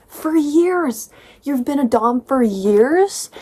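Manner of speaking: can't believe it; exasperated real disbelief; incredulous. Real, not acted. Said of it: "incredulous rant".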